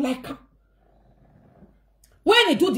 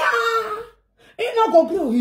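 mock wailing plea, higher; crying; 9 semitones higher, slower than usual. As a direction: imitate her crying out. Sister crying on phone.

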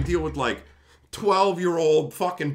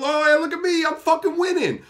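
mocking gloat; 9 semitones higher, 4 dB louder than usual. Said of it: "then you'll feel".

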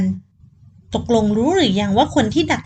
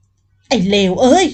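louder, slow spat-out curse; angry curse; 5 dB louder, much slower than usual. Acted. Boss cursing the rival's man on hearing the news, loud angry curse. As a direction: curse the bastard in fury. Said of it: "พูดมาด้วยความโมโห".

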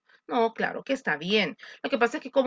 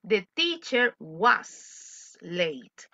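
slow dictation; 2 semitones lower, much slower than usual. Shown as a demonstration. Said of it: "utilizamos was".

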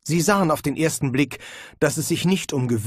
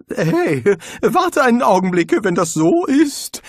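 high, loud flustered objection; panicky, confused protest; 7 semitones higher, 6 dB louder than usual. A narrator's reading. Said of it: "wandte Rincewind verwirrt ein".

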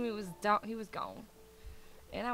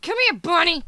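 loud, raised calling voice; exasperated call; 5 semitones higher, 10 dB louder than usual. Real, not acted. Player calling her straying bunny, loud and exasperated. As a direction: call the stray pet back sharply.

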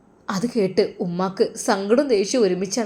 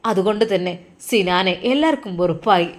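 ordinary delivery, nothing marked

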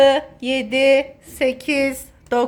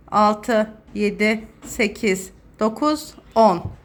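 ordinary talk

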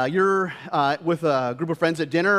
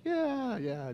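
mock placating; 10 semitones higher, 5 dB quieter than usual. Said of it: "It's like".

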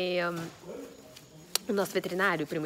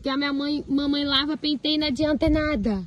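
normal talking voice

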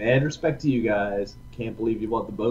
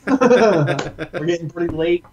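slow, laughing words; 6 semitones higher, 7 dB louder, much slower than usual. Real, not acted. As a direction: laugh through it. Laughing while speaking.

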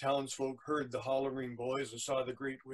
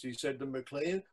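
ordinary delivery, nothing marked

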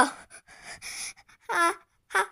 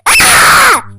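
loud high pained yell; crying yell demo; 7 semitones higher, 8 dB louder than usual. Shown as a demonstration. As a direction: show how you cried out, loud. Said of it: "이렇게 울었거든요".

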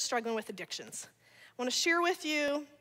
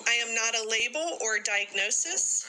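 creed recitation; 2 semitones lower than usual. Acted. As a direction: lead the room line by line. Leads daily creed, class repeats.

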